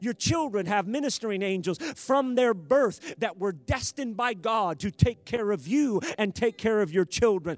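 loud, driving sermon delivery; full-force preaching. Real, not acted. Preacher telling congregation not to fear, preaching forcefully. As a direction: build in force to an urgent exhortation.

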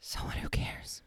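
slow whispered snark; sarcastic whisper. Real, not acted. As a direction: whisper it dryly aside. Sarcastic aside.

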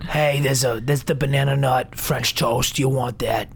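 lightly voiced low waitress; waitress impression. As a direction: offer the dish in a put-on waitress voice. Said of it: "Does she kinda talk like this?".

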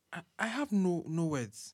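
soft, halting reply; subdued, stammering murmur; stunned speechless. Acted. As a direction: falter quietly, lost for words. Accused father left stunned.

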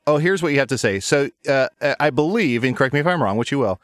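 normal talking voice

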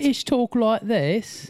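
high, slow put-on British accent; hammed-up British accent, drawn out and high; mock British accent. Shown as a demonstration. Australian showing Americans how the British talk, mock British accent. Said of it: "difference between a British and an Australian".

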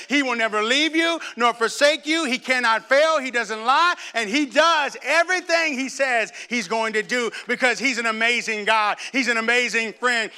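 high, loud, slow preaching build; preacher rising to a crescendo; full-force preaching. Acted. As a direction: proclaim it to the whole church. Preaching climax about Jesus.

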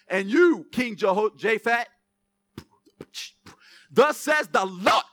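loud, proclaiming preacher voice; preaching. Acted. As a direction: announce the prophet's message. Preaching the prophet's words.

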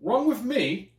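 high, indignant mock British accent. Husband retorting to wife, in high mock British accent. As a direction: swoop up high in a put-on British voice, slowing. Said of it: "spoke in a British accent".

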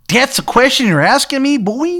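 put-on high, loud, terrible Maryland accent; Shithead Steve's Maryland accent. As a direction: question them in a hammed-up Maryland twang. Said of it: "he's got, like, a terrible Maryland accent".

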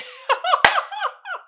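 loud, high burst of laughter; high shrieking laugh. Real, not acted. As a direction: crack up hard. Bursts out at the meme.

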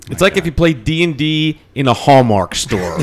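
normal talking voice

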